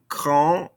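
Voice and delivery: "grand" ending on the nasal vowel, no D; silent ending consonants. Shown as a demonstration. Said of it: "don't pronounce these ending consonants".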